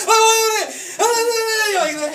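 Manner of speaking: very high, loud mock wail; kid facing a shot. Child begs before getting a shot, hammed-up panicked wailing. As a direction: plead in imitation of a panicking child. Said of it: "like when they're gonna give the kids a shot".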